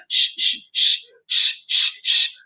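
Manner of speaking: soft unvoiced shushing; a long hissed shh, shh, shh; hushing shh. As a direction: hush the room like a frightened crowd. Mimicking people hushing each other.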